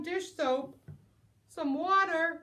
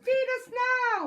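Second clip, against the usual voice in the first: much higher, louder raccoon shout; loud raccoon holler; 12 semitones higher, 8 dB louder than usual. Acted. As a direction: holler for food. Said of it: "The raccoons hollered".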